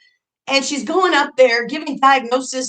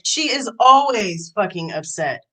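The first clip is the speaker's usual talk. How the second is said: usual speaking voice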